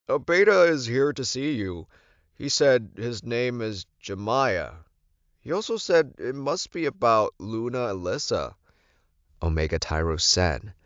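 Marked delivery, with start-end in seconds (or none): 0.1-8.5 a hint of a careful messenger; dutiful servant. Omega Tyros announcing the beta Jemiah to the Alpha, dutiful.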